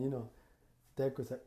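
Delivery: ordinary talk, nothing marked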